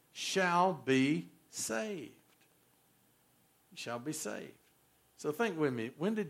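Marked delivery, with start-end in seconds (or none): 0.1-2.1 shall be saved stretched very slowly; preacher stretching the verse's final words; drawn-out scripture ending. Acted. 3.7-4.5 Shall be saved repeated much softer and lower; preacher murmuring the verse back; soft echo. Acted.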